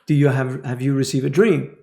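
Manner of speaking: loud blunt mock questions; tactless questioning. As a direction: fire off the questions too loudly. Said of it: "you don't say to the person".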